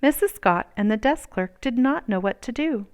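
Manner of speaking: plain reading voice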